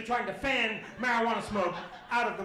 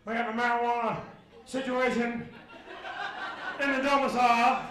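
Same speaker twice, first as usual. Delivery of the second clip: a hint of a stiff official cop, loud; 4 dB louder than usual. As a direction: play the formal officer, loudly. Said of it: "very cop-like, very".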